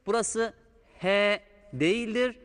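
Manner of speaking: normal talking voice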